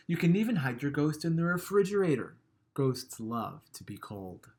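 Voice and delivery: plain reading voice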